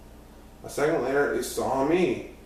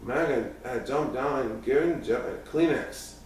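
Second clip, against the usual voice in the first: plain reading voice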